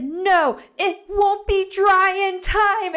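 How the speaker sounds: put-on high, slow, sing-song child's whine; drawn-out kid's protest, sung; whiny toddler. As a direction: whine it like a small boy. Son whining his refusal.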